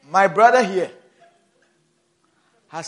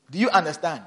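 usual speaking voice

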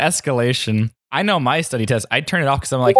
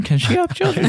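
incredulous. Real, not acted. Incredulous follow-up question.

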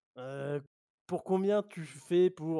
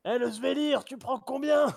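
calling out; 9 semitones higher, 3 dB louder than usual. Acted. Player hails the boatman.

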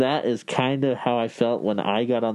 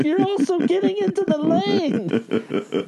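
slow, much higher squeaky mock exasperation; exasperated instructor; slower than usual. Instructor scolding student's merging, slow squeaky high mock voice. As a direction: drag it out like a fed-up instructor. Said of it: "He's like".

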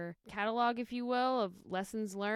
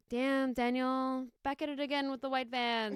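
Vine catchphrase impression; 5 semitones higher, 3 dB louder than usual. Quoting an old Vine to the co-host, catchphrase impression. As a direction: imitate the viral video's delivery. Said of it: "That was a Vine".

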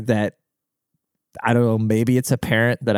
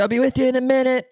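high curt receptionist; 13 semitones higher, faster than usual. Retold or imitated. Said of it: "She's like".

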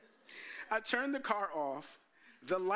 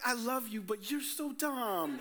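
a higher voice, slowing on so dumb with a laugh; fond exasperation; 5 semitones higher, slower than usual. Retold or imitated. Girlfriend looking at her dumb boyfriend, lovingly exasperated. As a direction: tease him fondly, drawing out the end.

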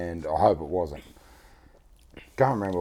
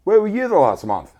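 mock indignant cry; 12 semitones higher, 8 dB louder than usual. Complaining to friends.

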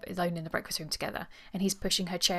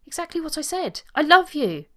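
exasperated yell building to full volume; loud, forced declaration of love; shouted declaration; 10 semitones higher, 11 dB louder than usual. A narrator's reading. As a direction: shout the confession, high and loud. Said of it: "You needn't shout".

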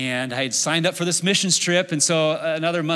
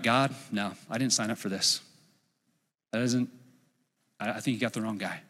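scared refusal; 5 semitones lower, 7 dB quieter than usual. Retold or imitated. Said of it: "I was so scared".

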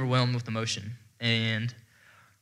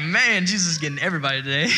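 higher, exclaimed with a laugh at the end; amused amazement; 4 semitones higher, 3 dB louder, faster than usual. Retold or imitated. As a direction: marvel at it, tipping into laughter. Joking retold reaction, laughing.

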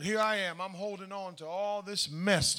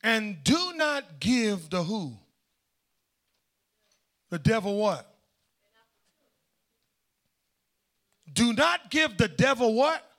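slow questions rising louder; call and response; 4 dB louder than usual. Shown as a demonstration. Preacher prompting the congregation to fill in the word, louder. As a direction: draw the crowd to answer. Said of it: "Look what he said".

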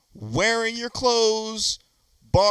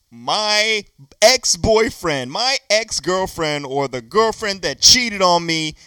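ordinary delivery

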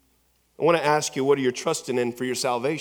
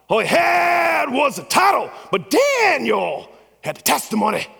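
impersonating a fiery preacher; 11 semitones higher, 6 dB louder than usual. Retold or imitated. Pastor contrasting Pharaoh's title with Moses' testimony, shouted preaching. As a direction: build each title line up to a cry. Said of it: "He goes".

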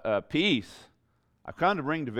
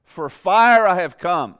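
"fire" hit hard, high and loud, slowly; emphatic fire; 7 semitones higher, 13 dB louder, slower than usual. Shown as a demonstration. Preacher showing the emphatic Greek word order, stress on "fire". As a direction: lean all your weight on "fire". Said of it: "the fire part is emphatic".

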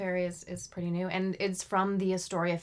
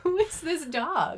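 high-pitched mock question; 9 semitones higher, 3 dB louder, slower than usual. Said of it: "It's like".

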